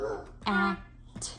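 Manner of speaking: "Huh", "Ah", "T" as isolated phonics sounds of hat; segmenting sounds. Shown as a demonstration. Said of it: "You're segmenting it".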